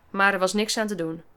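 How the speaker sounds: ordinary delivery, nothing marked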